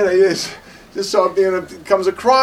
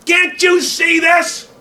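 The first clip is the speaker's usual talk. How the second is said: slow, much higher, loud shout; retold angry shout; 9 dB louder, much slower than usual. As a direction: yell it slowly, pointing at the jaw. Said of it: "He says".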